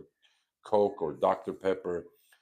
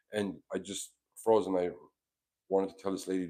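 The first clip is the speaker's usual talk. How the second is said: ordinary talk, nothing marked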